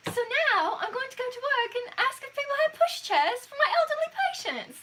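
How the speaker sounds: put-on high British accent slipping towards Australian; attempted British accent. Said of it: "That sounded kind of Australian".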